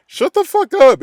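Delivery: loud, high shouted put-down; angry outburst. Real, not acted. Fan yelling back at the critics, loud and furious.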